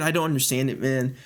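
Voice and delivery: usual speaking voice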